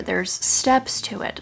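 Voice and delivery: ordinary talk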